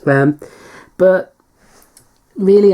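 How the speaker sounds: hiccuping between words; talking through hiccups. Real, not acted. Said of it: "I've got the hiccups now".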